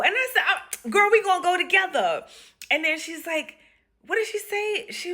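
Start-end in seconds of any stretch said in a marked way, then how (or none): 0.5-2.2 high, hyped-up reply; acted girlfriend excitement; enthusiastic agreement. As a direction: play along with gusto, pitch raised. Humours patient, acted.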